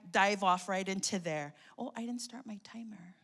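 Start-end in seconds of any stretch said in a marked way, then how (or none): 1.8-3.1 unvoiced whisper to herself; whispered aside. Real, not acted. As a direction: mutter the reminder under the breath.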